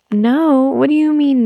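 mock surprise. Baby refuses cleaning.

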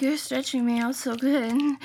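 ordinary talk

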